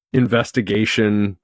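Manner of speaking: ordinary delivery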